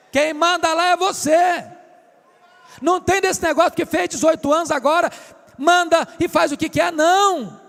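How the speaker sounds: loud, high, driving preacher shout; forceful preaching. Real, not acted. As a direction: thunder it out to the congregation.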